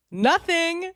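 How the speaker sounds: high, loud, indignant answer; exasperated outburst. Real, not acted. Commentator on what he does after her speech, exasperated. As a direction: throw the word out in exasperation.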